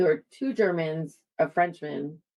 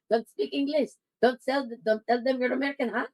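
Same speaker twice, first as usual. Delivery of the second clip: higher, quick fake Filipino accent; fake Tagalog accent; 6 semitones higher than usual. Whispering to her friend on the train in a quick fake Filipino accent. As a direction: rattle it off to pass as Tagalog.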